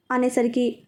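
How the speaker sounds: ordinary delivery, nothing marked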